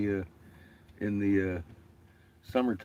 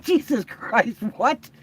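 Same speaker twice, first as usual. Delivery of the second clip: shocked; 11 semitones higher, slower than usual. Retold or imitated. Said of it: "I was like".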